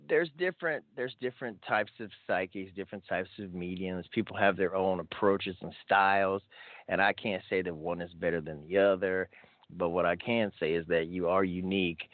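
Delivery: ordinary talk, nothing marked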